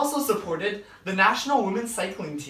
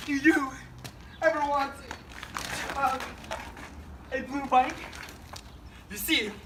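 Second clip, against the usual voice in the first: cheesy ad read; 6 semitones higher than usual. Acted. Pitching a blue bike to viewers, hammed-up commercial voice. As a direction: sell the blue bike like an infomercial host.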